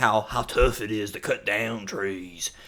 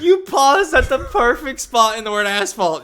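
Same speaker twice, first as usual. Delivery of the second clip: laughing; 10 semitones higher, 5 dB louder, slower than usual. Real, not acted.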